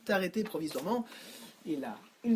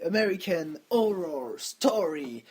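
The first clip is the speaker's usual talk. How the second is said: slow, mock English accent on the title; American Horror Story in bad English; 4 dB louder, slower than usual. Real, not acted. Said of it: "avec mon accent mauvais anglais".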